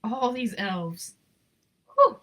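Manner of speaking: plain reading voice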